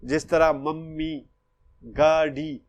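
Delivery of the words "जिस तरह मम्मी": ordinary delivery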